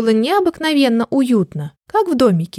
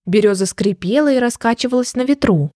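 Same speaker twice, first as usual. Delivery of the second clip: plain reading voice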